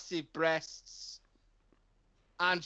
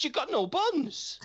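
indignant; 10 semitones higher than usual. Retold or imitated. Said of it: "I said".

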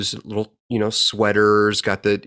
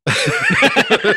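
high burst of laughter; 5 dB louder than usual. Real, not acted. Friend's joke lands.

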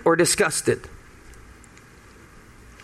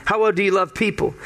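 ordinary talk

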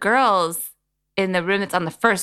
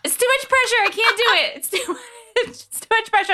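real laughter breaking through, voice much higher; laughing; faster than usual. Real, not acted. Put on the spot by her friend, laughing high and flustered. Laughing under pressure to perform.

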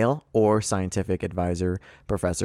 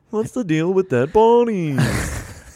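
high, loud, drawn-out stand-up whine; hammed-up observational comic, pitch way up; Seinfeld; 10 semitones higher, 6 dB louder, much slower than usual. Host riffing on the synopsis, mock stand-up comedian. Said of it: "It's my Seinfeld voice".

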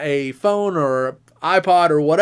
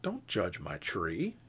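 low and much softer, slow; wounded pride, much lower and softer; mock hurt; 11 semitones lower, 17 dB quieter, slower than usual. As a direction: mutter it defensively, low and slow. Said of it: "And I went".

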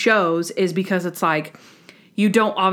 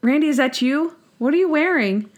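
mock surprise; 6 semitones higher than usual. Imitating Monk's surprise.

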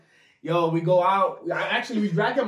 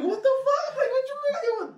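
high, incredulous outburst; shocked. Retold or imitated. Narrator retelling his shock at friend, high disbelief. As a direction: sound stunned and disbelieving.

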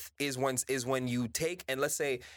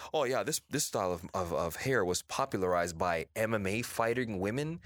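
lowered voice mimicking a false claim; mocking quote; 7 semitones lower than usual. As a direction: drop lower to parody the rebranding. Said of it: "try to change it into".

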